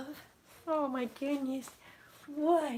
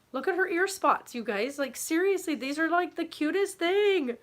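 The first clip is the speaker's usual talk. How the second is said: normal talking voice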